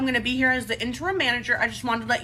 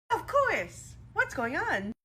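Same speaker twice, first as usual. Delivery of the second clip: mildly sugary, higher, fake-polite; a hint of Stepford sweetness; Stepford wife; 6 semitones higher, much slower than usual. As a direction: play a syrupy perfect wife, lightly. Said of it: "Sound like a Stepford wife".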